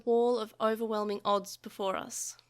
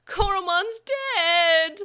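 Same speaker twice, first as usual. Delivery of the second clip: loud, high, slow wail of grief; boy wailing over his fallen partner; 9 semitones higher, 10 dB louder, much slower than usual. A narrator's reading. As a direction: cry it out in despair. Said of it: "Taichi wailed".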